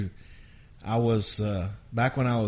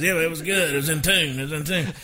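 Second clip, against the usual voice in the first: raised, insistent producer impression; mock the co-writer's eager insistence, pitched up; imitating Craig Wiseman; 6 semitones higher than usual. Songwriter imitates co-writer insisting the yawn worked, raised eager voice. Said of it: "I'm doing a Craig Wise imitation".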